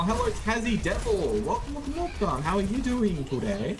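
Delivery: high, lilting greeting; singsong welcome. Pretend. Streamer welcoming a viewer in a high sing-song voice.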